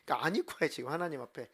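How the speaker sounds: ordinary talk, nothing marked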